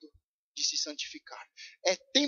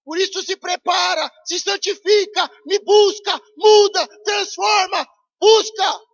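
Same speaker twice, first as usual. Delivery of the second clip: loud, higher preached cry in God's voice; preaching at full force; 8 semitones higher, 9 dB louder than usual. Acted. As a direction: hammer each command home.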